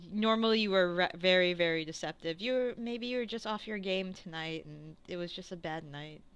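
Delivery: soft, trailing-off reassurance; gentle consoling. Acted. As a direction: comfort a downhearted friend softly. Game master's character consoles a friend.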